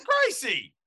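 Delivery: high, loud, fed-up call of the name; exasperated dad. Retold or imitated. Dad calling his daughter's name, high and exasperated. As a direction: scold the kid in disbelief.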